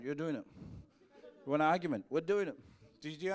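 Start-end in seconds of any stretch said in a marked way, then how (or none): 1.4-2.6 louder, playful challenging punch; louder challenge. Acted. Pastor challenging the stubborn congregant, louder and playful. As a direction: dare them to argue, then close it. Pastor wins the mock argument.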